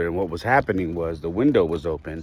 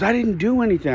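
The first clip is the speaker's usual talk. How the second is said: much higher, imitated kid's protest; child's indignant protest; 14 semitones higher than usual. Son protests again in the quarrel.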